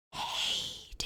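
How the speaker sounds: soft ghostly whisper calling a name; beckoning ghost. Acted. Ghost brother calling Anaconda from his sleep, whispered. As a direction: lure him from sleep in a whisper. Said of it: "in a beckoning tone".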